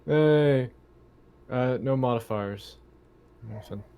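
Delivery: ordinary talk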